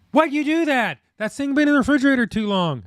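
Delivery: high mock scolding wife voice. Husband imitating his wife scolding him, high mock scold. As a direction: pitch it way up, playing the nagging wife. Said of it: "I'm like".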